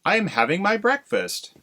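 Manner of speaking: high, smug snake voice, mouth full. Acted. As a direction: talk through the eaten egg. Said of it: "Speaking with his mouth full".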